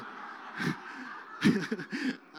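breathy silent chuckle at the mix-up. Real, not acted. Teller laughs at misidentification.

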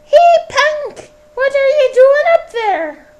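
very high, loud Scottish woman yelling; old Scottish woman calls out to the lad. Acted. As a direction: shout up at him as a shrill old Scottish woman. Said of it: "in various different accents".

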